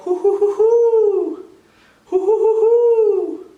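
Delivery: high, loud hooting call of a great horned owl. A narrator's reading. Pa calling out to the owl with a loud, high hoot. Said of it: "And then Pa called".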